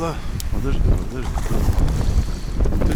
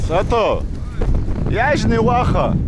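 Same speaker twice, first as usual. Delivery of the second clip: excited call; 12 semitones higher than usual. Real, not acted. Calls out to friend while fishing.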